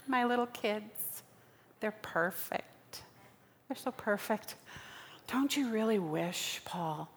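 normal talking voice

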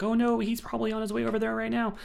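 high, fast mock alarm; mock panic. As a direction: rush it out in a hammed-up panic. Heroine panicking in realization.

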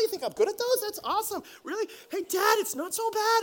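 put-on high little-girl excitement; delighted child. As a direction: imitate a kid thrilled by praise. Daughter warming up after praise.

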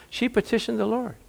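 ordinary delivery

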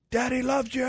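high-pitched dad cheer, mock yell; imitated sideline yell. Dad calls to his kids at the serve, mock yell. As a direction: call it out high, without full volume. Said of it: "that's what I would yell out".

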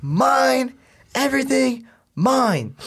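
high, slow threatening mom voice; imitating angry mom. Mom on speaker threatening her son, high and angry. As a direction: lay down the punishment like a furious mom.

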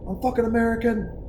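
a mock-indignant drunk American; drunk bar owner. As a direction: bristle like a hammered patriot. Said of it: "And he's like".

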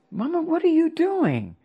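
high, startled plea to mother; a shocked high cry. Retold or imitated. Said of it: "And I said".

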